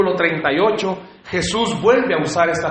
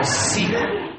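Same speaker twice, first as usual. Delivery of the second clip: high, soft mock-romantic call; playful mock courting; 11 semitones higher, 4 dB quieter than usual. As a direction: lift it high but keep it soft. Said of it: "pero le dijo".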